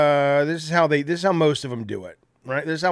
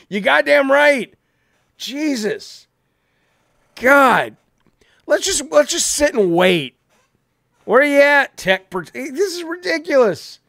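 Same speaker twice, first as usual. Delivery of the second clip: loud, higher, fed-up exclamations; exasperated outburst; 9 semitones higher, 3 dB louder than usual. Real, not acted. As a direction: vent at the empty chair. Fuming, waiting for missing cohost.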